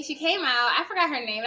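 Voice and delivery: usual speaking voice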